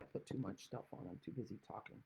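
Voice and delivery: whispered under her breath, to herself; muttered aside. Real, not acted. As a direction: mutter it half-voiced while busy. Muttered aside to herself while crafting.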